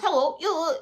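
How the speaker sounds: high-pitched British phone-voice imitation; mock British accent. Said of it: "they have British accent".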